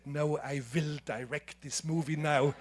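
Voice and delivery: a lightly put-on heavy German accent; firm, self-mocking German accent; bad German accent. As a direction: exaggerate your German accent a little. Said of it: "with my worst German accent".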